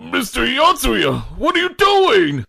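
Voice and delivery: shocked high cry. Acted. Onsen shrieks at Yatsuya grabbing Godai. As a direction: squeal it in shock.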